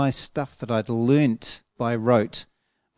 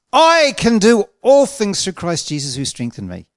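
loud, higher declaration leaning hard on I; stress on I; 7 semitones higher, 6 dB louder than usual. Retold or imitated. Preacher recalling how he used to declare scripture, stress on I. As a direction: punch "I" big and loud as you declare it. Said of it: "Where was the emphasis?".

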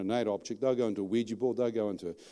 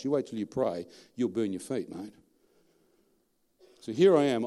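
ordinary delivery

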